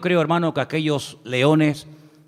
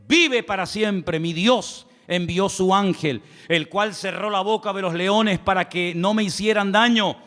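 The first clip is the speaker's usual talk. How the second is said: raised, slowed preacher's Bible reading; scripture reading; 6 semitones higher than usual. Acted. Preacher reading Daniel to the church, solemn and raised. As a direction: read the verse aloud to the congregation. Reads Daniel's answer aloud.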